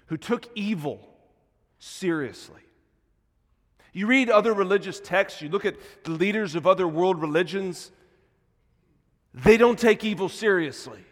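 emphatic pulpit declaration; forceful preaching. Real, not acted. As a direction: drive the point home loudly to the congregation. Preaches with raised voice.

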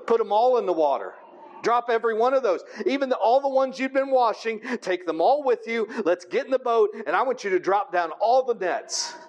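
ordinary delivery

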